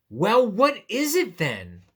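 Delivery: high, slow, demanding question; exaggerated storybook exasperation; exasperated question. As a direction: ask it fed up and baffled. Reads the exasperated question to bunny.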